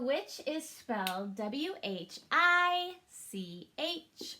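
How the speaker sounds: letters spelled out slowly, one by one. Shown as a demonstration. Teacher spelling out the word for kids, slowly letter by letter. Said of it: "Let's arm tap that".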